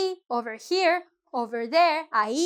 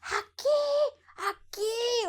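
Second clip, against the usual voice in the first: screaming; 9 semitones higher, 5 dB quieter, much slower than usual. Acted. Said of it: "people start screaming".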